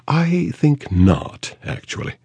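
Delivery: slow, high, haughty English refusal; drawn-out upper-class brush-off; Mayfair accent. A narrator's reading. Actress turning down the detective, slow haughty Mayfair accent. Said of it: "the Mayfair accent".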